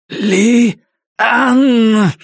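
high, drawn-out cry of the name; crazed cry. Acted. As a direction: cry out like a madman. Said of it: "словно сумасшедший повторял он".

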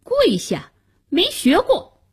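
high-pitched cheeky retort; defiant boy. A narrator's reading. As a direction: toss the order back like an unyielding boy. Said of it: "夏楠把头一扬".

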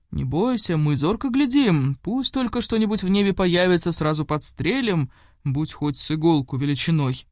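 usual speaking voice

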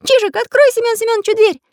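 very high character voice; little artist's request. A narrator's reading. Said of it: "попросил волшебный художник".

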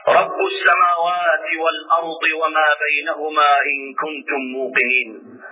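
low, steady, unshakeable reply; Moses, steadfast. Retold or imitated. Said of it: "على ثبات أثبت من الجبال الرواسي".

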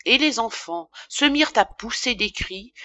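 usual speaking voice

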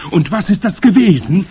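high, loud crowd screech; shrieked question. A narrator's reading. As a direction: shriek it with eager curiosity. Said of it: "kreischten die Zuhörerinnen".

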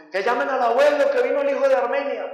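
loud excited call to the family. Retold or imitated. Relatives announcing the son's arrival, loud call. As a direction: shout it across the house.

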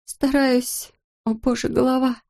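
high, strained voice in pain; pained astronaut. A narrator's reading. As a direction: groan through a head injury.

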